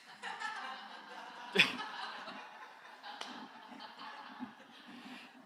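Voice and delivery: high breathy laugh at her own joke. Real, not acted. Teacher laughs at her chest-out walking tip, high and airy. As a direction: let out a light airy laugh.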